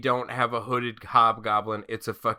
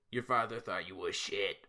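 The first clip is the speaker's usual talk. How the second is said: lightly voiced low, quiet Spider-Man; a hint of mopey Spider-Man, softer; mumbled mock Tobey Maguire; bad Spider-Man voice; 5 semitones lower, 5 dB quieter than usual. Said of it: "that's just my bad Spider-Man voice".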